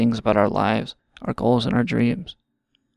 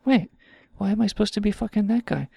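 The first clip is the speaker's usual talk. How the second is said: much higher, puzzled woman voice; mocking a baffled woman, much higher; mock woman's voice; 8 semitones higher than usual. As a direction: play a confused woman, high and quick at the end. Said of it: "they're like".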